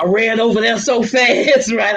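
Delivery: high, excited retelling with a laugh; excited laughing rush. Retold or imitated. Telling the host about running to his cousin, laughing and excited. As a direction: tell it like you still can't believe it. Joyful retelling of prison visit.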